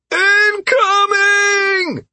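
very high, long-drawn warning yell; shattering shout. A narrator's reading. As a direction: holler the warning across a forest. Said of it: "Constantine's voice shattered the relative silence".

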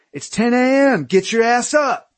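high, shouted drill-sergeant orders. A narrator's reading. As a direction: bark it like a drill sergeant rousing recruits. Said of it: "He sounded like a drill sergeant".

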